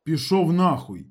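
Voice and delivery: curt retort. A narrator's reading. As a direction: throw it back curtly. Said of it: "так само коротко відповів йому Калюня".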